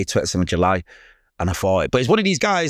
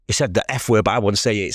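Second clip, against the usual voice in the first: normal talking voice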